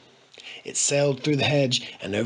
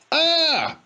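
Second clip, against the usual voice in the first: very high frightened yelp; shocked scream; 5 dB louder than usual. A narrator's reading. Boy frightened by the tramp, very high yelp. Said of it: "cried Charlie".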